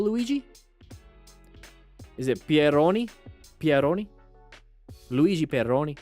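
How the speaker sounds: playful mock Italian accent, questioning lilt; pretend Italian accent. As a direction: roll out the name in a lightly put-on Italian accent. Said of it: "pretend I have an accent".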